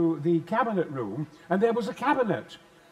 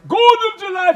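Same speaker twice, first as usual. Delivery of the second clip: huge roar, much higher and louder; roaring his famous line for a fan; booming shout; 14 semitones higher, 13 dB louder than usual. Retold or imitated. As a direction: bellow it out on request. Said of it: "would you mind saying Gordon's alive".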